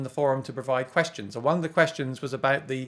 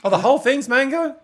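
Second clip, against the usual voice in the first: surprised; 10 semitones higher, 8 dB louder than usual. Real, not acted. Learns the whole guitar is mango.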